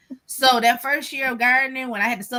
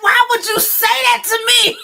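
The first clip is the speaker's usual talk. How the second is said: higher, louder outraged protest; indignant; 11 semitones higher, 6 dB louder than usual. Retold or imitated. She protests to her friend who mentioned snakes, higher and louder. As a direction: protest like she betrayed you. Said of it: "I was like".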